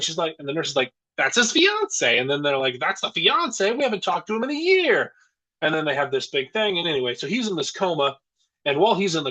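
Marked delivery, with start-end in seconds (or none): 1.2-2.2 high, drawn-out retold reveal; lightly acted big reveal; mock announcement. Acts out nurse announcing the fiance. 2.8-5.1 high-pitched retold disbelief; lightly acted shock; mock astonishment. Acts out family's astonished reply.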